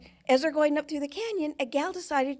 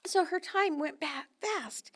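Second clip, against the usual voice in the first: ordinary talk, nothing marked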